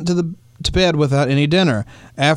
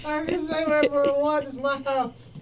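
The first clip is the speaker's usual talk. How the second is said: laughing; 8 semitones higher, 10 dB quieter than usual. Real, not acted. Laughing defiant.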